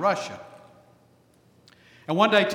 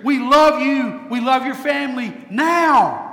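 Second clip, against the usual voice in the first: much higher, much louder, ringing welcome; joyful shout; 8 semitones higher, 10 dB louder than usual. Acted. Congregation calling their new pastor, shouting welcome. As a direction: shout it with open-armed joy. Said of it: "Tell your new pastor when he gets here".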